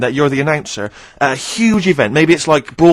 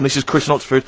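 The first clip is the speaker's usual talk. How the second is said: ordinary talk, nothing marked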